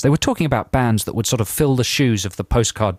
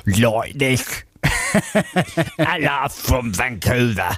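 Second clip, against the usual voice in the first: put-on slow, sing-song Vancouver accent; a lightly put-on Vancouver lilt; much slower than usual. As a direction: croon it like a Vancouver local. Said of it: "How do you do a Vancouver accent?".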